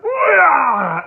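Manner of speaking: a sudden high, very loud squealing yelp; imitated squeal. Terrified teen squealing as a friend jumps on him. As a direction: let out a startled shriek, way up high. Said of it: "never heard a human being squeal like that".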